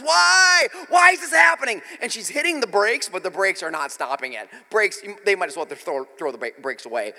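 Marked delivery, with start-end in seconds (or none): 0.0-1.8 high, loud imitation of a panicked yell; mimicked scream of alarm; retold yell. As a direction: yell it like the sister flying past. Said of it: "she's yelling as she's going by".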